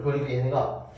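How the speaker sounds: normal talking voice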